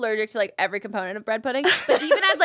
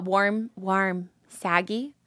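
cowboy twang; 5 semitones lower, 3 dB quieter, much slower than usual. Shown as a demonstration. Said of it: "Say it in the Western accent".

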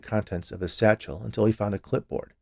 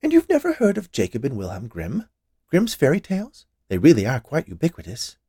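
higher-pitched, incredulous woman; insistent confectioner; 5 semitones higher than usual. Acted. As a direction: press him as if he must know. Confectioner presses the inspector.

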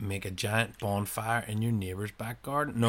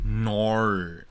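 drawn-out Australian No; Aussie no; 3 dB louder than usual. Retold or imitated. Last round of Aussie impressions, broad Aussie accent. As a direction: let No slide through Aussie vowels. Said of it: "Also, Australians go".

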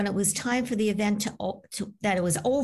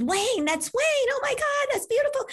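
reliving the thrill of finding the sign; excited gasp. Retold or imitated. Telling the host how she thanked Wayne, high and thrilled. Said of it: "And I was so excited".

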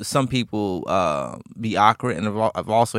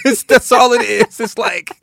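laughing; 13 semitones higher, 10 dB louder than usual. Real, not acted. Laughing emphasis.

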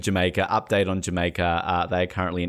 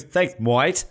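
higher, put-on Aussie "moit"; attempted Australian accent; 5 semitones higher than usual. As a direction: imitate a Canadian trying Australian. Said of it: "tries to do an Australian accent".

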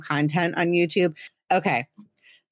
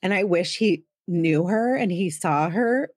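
ordinary talk

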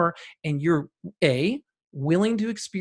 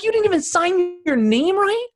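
much higher and louder, incredulous question; disbelief; 13 semitones higher, 6 dB louder than usual. Retold or imitated. Guest mocking the clinic's paperwork, incredulous and much higher. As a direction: throw it out in disbelief, voice way up. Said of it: "I'm like".

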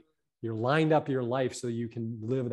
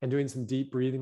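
ordinary talk

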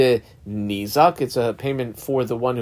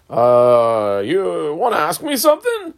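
acted inviting question; 2 semitones higher, 4 dB louder, slower than usual. Rav Nachman to his visitor, playfully inviting a question.

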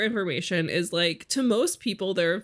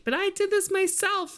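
high mock protest; 12 semitones higher than usual. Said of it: "when she's like".

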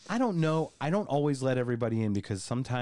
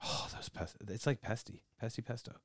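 hushed grumble at pesto; muttering; 10 dB quieter than usual. Pretend. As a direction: curse it under your breath.

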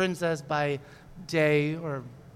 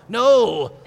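loud, higher monster "No"; 6 semitones higher, 10 dB louder than usual. Acted. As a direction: bellow it like a goo monster. Goo monster refuses loudly.

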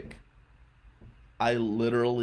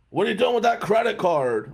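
louder, higher, challenging clerk voice; rude hotel clerk; 8 semitones higher, 5 dB louder than usual. Retold or imitated. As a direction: imitate a clerk accusing a guest. Rude hotel clerk snaps at guest.

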